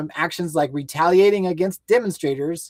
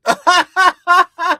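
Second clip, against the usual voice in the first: laughing mock cry; 9 semitones higher, 9 dB louder than usual.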